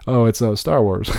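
usual speaking voice

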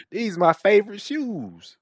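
high, slow mock voice for the quote; high slow impression of a man showing off his shoes; imitating him. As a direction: play the proud owner, drawn out. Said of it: "he was like".

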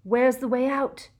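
loud, high frantic shout; desperate shout. A narrator's reading. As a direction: yell it in panic. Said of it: "he shouted desperately".

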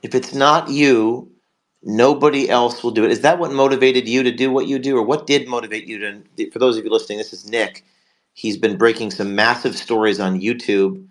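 ordinary delivery

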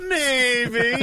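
coy Yiddish clown; high, loud, teasing clown voice with a rising end; Doctor Mindbender. Acted. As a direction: play it coy and squeaky-high. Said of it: "now a Yiddish clown and very coy".

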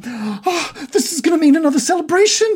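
very high, loud gushing; high-pitched overjoyed squeal. Acted. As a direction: burst out thrilled, no longer containing it. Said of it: "his excitement level j-, is just raised".

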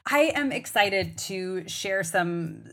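usual speaking voice

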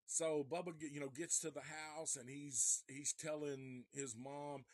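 ordinary talk, nothing marked

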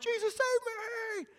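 high, slow cry of panic; scream for help. Retold or imitated. Sinking Peter cries out to Jesus, high desperate wail. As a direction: wail it like a drowning man. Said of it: "He's screaming".

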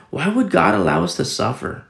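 imitating a whining question; mock complaint. Preacher mimics doubters asking why God allows suffering, mock whine. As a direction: voice the complainers, a little pushy. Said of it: "they say".

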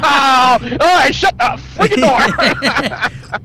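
loud, slow, exasperated yell; mock exasperated shout. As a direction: give in with a loud, fed-up yell. Plays the annoyed driver giving in.

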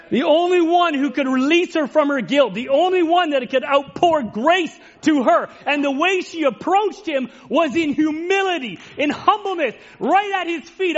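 fervent sermon delivery; impassioned preaching. Acted. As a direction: preach it out with fervour, climbing. Climax of the sermon.

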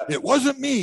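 high mock insistent denial; imitating a friend, high and insistent; determined denial. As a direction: play the stubborn friend denying it. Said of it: "he was all determined".

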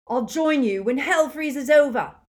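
loud, high defiance; defiant. A narrator's reading. Neville refusing Voldemort, loud defiance. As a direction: spit it back loudly.

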